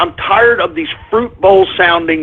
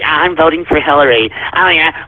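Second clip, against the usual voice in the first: mocking fruity voice; 4 semitones lower than usual. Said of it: "You fruity asses that thought it was so cute".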